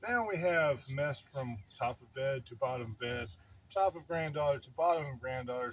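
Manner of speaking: ordinary talk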